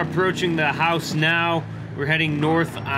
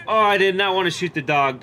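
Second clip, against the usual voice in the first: usual speaking voice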